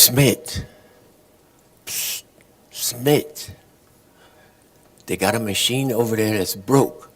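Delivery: hushed, secretive tip-off; mock secret casino whisper; conspiratorial hush. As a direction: lean in and keep it low and sneaky.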